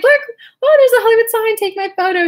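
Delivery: squealing high and loud with delight; imitating an overjoyed friend at the Hollywood sign; excited friend. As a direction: gush like a thrilled tourist. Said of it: "She was so excited".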